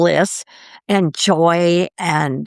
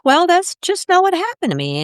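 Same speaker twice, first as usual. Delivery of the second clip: plaintive; 11 semitones higher than usual. Retold or imitated. Retold complaint, much higher pitch.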